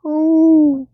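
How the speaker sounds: high, loud, ghostly moaning; eerie wail. Retold or imitated. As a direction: wail it like something unseen in the dark. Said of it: "And it's going".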